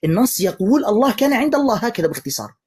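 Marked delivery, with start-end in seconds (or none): 0.8-1.8 incredulous mock quote. Mocking the verse's logic.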